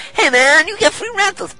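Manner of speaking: high, loud, eager call; keen customer. Acted. Customer urging a friend about free rentals, high and excited. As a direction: pitch it way up and call out to a mate.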